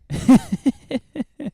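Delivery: a real burst of high laughter; high, bright laugh. Real, not acted. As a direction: laugh out loud at the story. Listener laughs at story.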